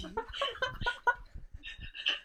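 long high-pitched laugh at the absurdity; high helpless laughter. Real, not acted. Laughs at her own story.

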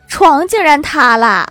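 loud, slow, incredulous punchline; comic disbelief. Retold or imitated. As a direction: stretch it out loudly in mock horror. Comic climax of scary tale.

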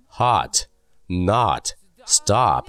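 hot, not, stop with an open "ah" vowel; American short O. Shown as a demonstration. Teacher gives example words, hot, not, stop with ah. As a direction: say hot, not, stop slowly, one by one, as "haht, naht, stahp". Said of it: "这些词就不会读成我们以前读的".

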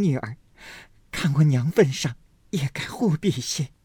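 put-on high, tearful young girl's voice; tearful plea. A narrator's reading. Daiyu pleads through tears with grandmother, high tearful voice. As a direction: cry out in disbelief. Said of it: "抱着贾母的腰哭道".

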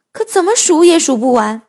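ordinary delivery

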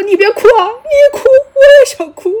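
high-pitched, hammed-up weepy voice; mock tearful wail. Judge joining the weeping woman, mock aggrieved crying. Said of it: "牛德草跟着就委屈上了".

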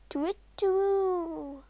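high, drawn-out owl hoot. Acted. Owl hoot imitated in the story.

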